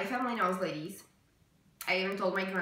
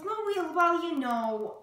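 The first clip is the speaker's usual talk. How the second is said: higher, drawn-out hedging as mom; imitating evasive mom; 9 semitones higher, 4 dB louder than usual. Mom answers her daughter's question about the sister, imitated evasively. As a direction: mimic mom dodging the question. Quoting mom's evasive reply.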